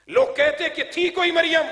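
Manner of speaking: high, loud declamation; impassioned preaching. Real, not acted. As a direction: cry it out to the congregation. Preaches to a crowd.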